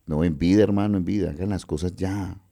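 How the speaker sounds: normal talking voice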